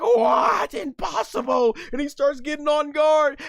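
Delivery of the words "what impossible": loud, higher What; villain shock. Retold or imitated. Goma stunned by the trick, loud shock. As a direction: bark it in disbelief. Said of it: "Goma's like".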